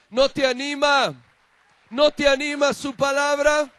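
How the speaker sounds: loud, rising scripture proclamation building to a cry; fervent preaching. Acted. Pastor to the congregation, proclaiming Isaiah's promise fervently. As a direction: proclaim God's promise with swelling fervor.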